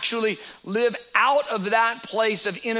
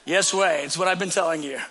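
normal talking voice

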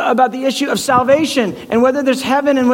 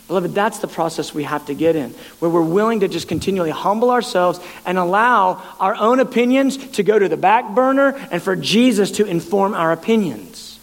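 ordinary talk, nothing marked

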